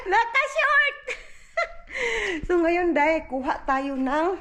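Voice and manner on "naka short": Naka-short squealed high through laughter; high giggly Naka-short; laughing high. Real, not acted.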